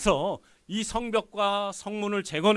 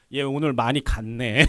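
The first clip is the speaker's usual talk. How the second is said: lower, louder incredulous scoff; king's mocking thought; 6 semitones lower, 6 dB louder than usual. King privately reacting to cupbearer's request, mocking. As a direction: scoff privately at an underling's nerve. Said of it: "속으로 그랬을 거야".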